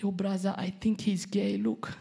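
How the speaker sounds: mock tearful. Crying mom confiding about brother, imitated tearful worry. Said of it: "she's crying".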